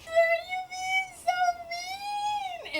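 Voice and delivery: very high, slow whiny mock voice; Clary whimpering back at Sebastian in a high mocking whine. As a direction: whine it like a wounded child. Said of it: "she's just there like".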